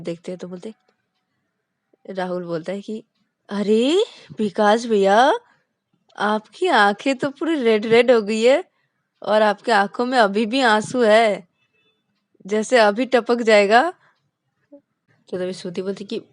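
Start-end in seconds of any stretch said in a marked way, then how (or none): 3.5-13.9 slow, loud, higher worried voice; concerned younger friend. Acted. Rahul worried about Vikas's teary eyes, slow and loud. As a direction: draw out each word as you notice his tears.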